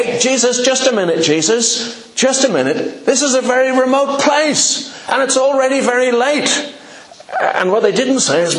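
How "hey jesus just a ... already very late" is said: impatient interruption. Acted. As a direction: cut in on the teacher, pushy and hurried. Disciples interrupting Jesus mid-teaching.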